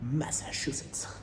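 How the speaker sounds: breathy whispered Massachusetts, slow. Shown as a demonstration. To viewers, showing off his accent: whispered Massachusetts. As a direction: whisper Massachusetts slowly, savouring the name. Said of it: "mon accent, il me sert à quelque chose".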